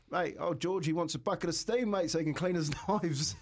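high, earnest retelling of the request; naive young apprentice. Retold or imitated. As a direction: play the gullible sixteen-year-old. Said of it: "I said".

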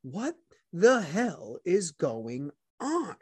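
slow, drawn-out bewildered question; exasperated. Retold or imitated. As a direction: hands up, what is going on, slowly. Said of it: "with your hands up in the air going".

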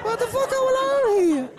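high squeaky mimicry; squeaky mock echo. Mocking S2's outburst, squeaky.

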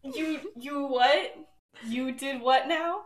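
mock-stunned stammering question; incredulous. Acted reaction to shocking news.

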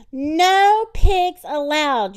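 slow, loud, high proclamation; angry shout. A narrator's reading. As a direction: shout it like a ban, drawn out. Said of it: "Eugenia shouted".

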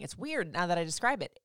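ordinary talk

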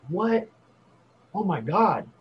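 sharp high "What?" then an amazed "oh my God"; retelling being floored by the offer; shocked disbelief. Retold or imitated. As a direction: react like you can't believe your luck. Stunned at free stash.

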